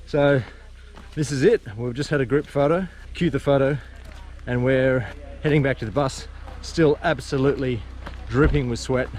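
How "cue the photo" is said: usual speaking voice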